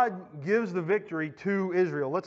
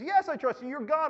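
acted urgent assurance; 8 semitones higher than usual. Dangling man anxiously assures God.

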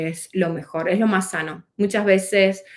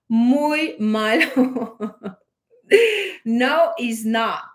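laughing through the words muy mal humor, slowly; 4 semitones higher, 3 dB louder, much slower than usual. Real, not acted. Admitting her old morning moods, laughing. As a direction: break into a laugh mid-phrase.

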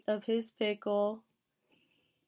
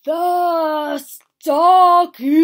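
very loud, high, drawn-out shout; exasperated bellow; 6 semitones higher, 14 dB louder, much slower than usual. A narrator's reading. Bear making Mouse finally notice, shouting. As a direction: bellow it, stretching both words long.

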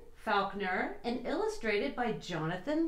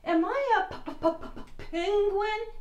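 high, slow, sing-song stammer; chattering teeth on p; shivering stutter; 9 semitones higher, slower than usual. Acted. Shivering penguin asks walrus.

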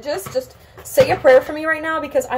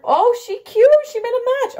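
high, louder mock-admiring squeal; playful squeal; 11 semitones higher, 4 dB louder than usual. Real, not acted. Vlogger cooing over her own haircut, playful squeal. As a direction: pitch it way up, gushing.

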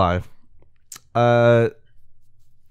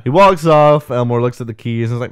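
ordinary talk

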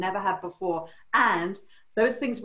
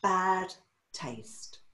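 crushing verdict; 5 dB quieter than usual. Retold or imitated. Mentor answering the stylist, slow deadpan verdict. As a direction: split the words, let the last one fall away.

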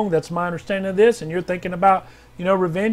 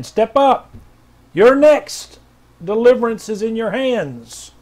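prophetic proclamation; 3 semitones higher, 4 dB louder, slower than usual. Acted. Pastor reading R J W's word, loud and commanding. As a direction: thunder it out like a prophet.

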